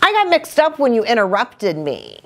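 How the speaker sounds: quick, high excuse; defensive little fish making excuses. A narrator's reading. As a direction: blame the other one hurriedly. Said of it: "Little Blue's quite defensive".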